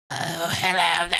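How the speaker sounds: high, slow put-on old-scholar voice; maester impression. Fan imitating the narrator's maester, high slow voice. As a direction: drag out a mock maester's greeting. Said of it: "every, like, maester's like".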